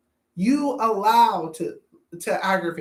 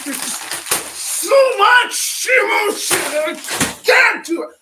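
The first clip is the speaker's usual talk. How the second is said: emotional outburst; 9 semitones higher, 6 dB louder than usual. Acted. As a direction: let it burst out, too much to hold. Acted emotional breakdown outburst.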